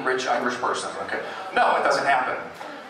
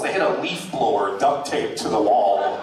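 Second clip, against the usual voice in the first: ordinary delivery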